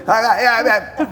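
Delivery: high, loud stammered cries into a phone; mock frantic calling. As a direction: imitate trying desperately to be heard. Imitates himself shouting into phone.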